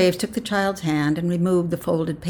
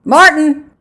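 high, loud roll call; 11 semitones higher, 13 dB louder than usual. A narrator's reading. Official calls the next man forward, loud. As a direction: call the name across a crowd.

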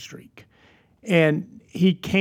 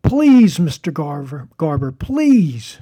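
put-on pleading kid; 5 dB louder, much slower than usual. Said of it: "he pleads with him".

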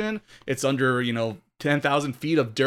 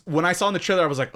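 ordinary talk, nothing marked

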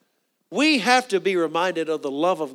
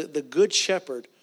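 usual speaking voice

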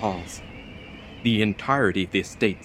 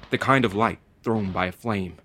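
plain reading voice

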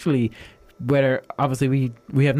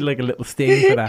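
ordinary talk

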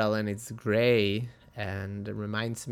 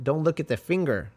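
imitated kung fu master, higher and emphatic; accented film-master impression, raised pitch; Bruce Lee; 4 semitones higher, 5 dB louder than usual. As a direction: scold the student like a martial arts teacher. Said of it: "and he's like".

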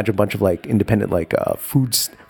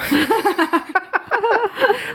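high laugh. Real, not acted. Laughing at the story.

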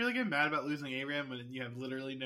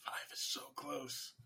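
whispering; 4 dB quieter, slower than usual. Acted. Dread about short exhaust range.

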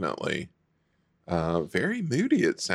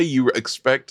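ordinary talk

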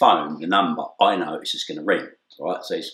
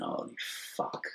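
hushed dread; 15 dB quieter than usual. Retold or imitated. Inner thought, dread.

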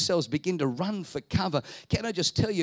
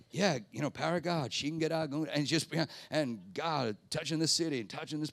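low, softer, laid-back prayer; imitating relaxed praying; 4 semitones lower, 5 dB quieter than usual. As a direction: pray casually, imitating an unhurried pastor. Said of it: "he was just so relaxed".

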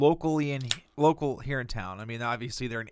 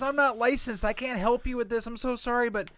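high, sing-song mock of a flustered clerk; mocking the agent lady; 9 semitones higher than usual. Mimicking the insurance lady's excuse, high and airy. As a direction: parody her breezy apology. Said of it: "And the lady says".

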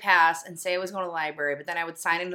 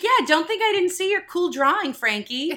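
high, loud teasing teacher; sarcastic teacher; 11 semitones higher, 5 dB louder than usual. Retold or imitated. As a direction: tease like a smug teacher. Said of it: "He's like".

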